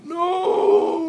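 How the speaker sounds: very high, loud hysterical cry; hysterical scream. Acted. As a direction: scream it in total panic. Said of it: "Frank hysterically".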